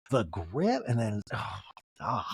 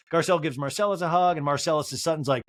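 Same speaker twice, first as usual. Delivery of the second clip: ordinary talk, nothing marked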